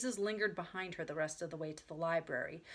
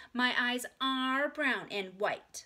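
put-on slow, higher, louder child voice; insistent little girl; 5 semitones higher, 5 dB louder, much slower than usual. A narrator's reading. Ramona corrects her big sister, slow and insistent. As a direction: insist on it slowly like a small child. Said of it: "Ramona always answered".

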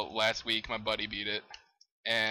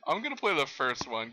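ordinary talk

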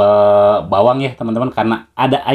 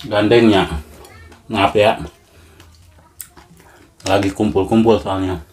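chewing while talking; talking between bites; mouth full; 2 semitones lower than usual. Real, not acted. Seen 0: cheeks look filled, lips moving while peeling food between bites.

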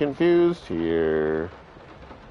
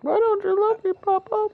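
put-on child voice; 3 dB louder than usual. Mimicking a sad child.

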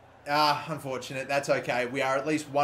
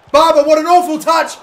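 loud outburst; 11 semitones higher, 14 dB louder than usual. Real, not acted. Sudden outburst at bad touch.

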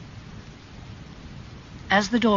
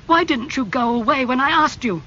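higher, louder rebuke; angry; 10 semitones higher, 5 dB louder than usual. A narrator's reading. Basil blaming Lord Henry, angry. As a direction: blame him outright. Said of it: "he asked angrily".

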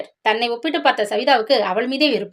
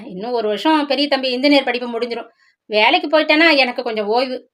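plain reading voice

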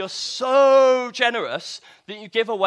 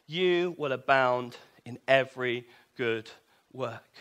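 scripture reading; 5 semitones lower, 3 dB quieter than usual. Shown as a demonstration. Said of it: "let's carry on reading".

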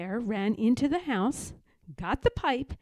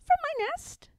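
very high, tiny bird voice; tiny baby robin. A narrator's reading.

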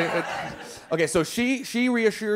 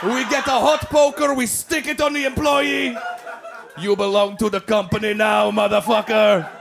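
Russians plotting. Retold or imitated. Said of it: "The Russians were like".

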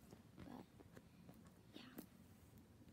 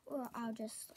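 normal talking voice

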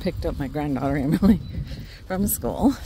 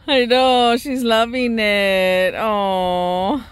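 delighted; 5 semitones higher, 5 dB louder, much slower than usual. Real, not acted. Delighted about dog on grass.

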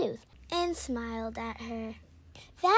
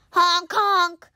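duck honk; 4 semitones higher, 9 dB louder than usual. Retold or imitated. Imitating a sick duck honking.

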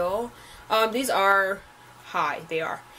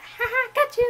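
teasing friend; 4 dB louder than usual. Retold or imitated. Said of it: "She was like".

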